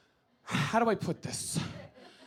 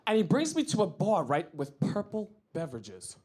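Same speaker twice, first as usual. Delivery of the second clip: ordinary delivery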